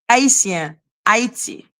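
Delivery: Haitien, Haiti with silent H; Creole pronunciation. Shown as a demonstration. Teaching how Creole says it, Creole pronunciation. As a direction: say Haitien and Haiti as ah-ee-syen, ah-ee-tee. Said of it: "In Creole, we say".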